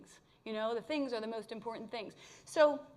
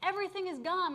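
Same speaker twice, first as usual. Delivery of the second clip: much higher, louder indignant protest; high, louder exasperated protest; exasperated outburst; 7 semitones higher, 5 dB louder than usual. Retold or imitated. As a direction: push back hard at the voice. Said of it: "I got more and more pissed".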